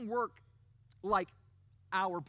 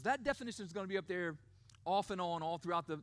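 normal talking voice

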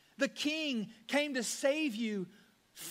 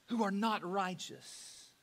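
normal talking voice

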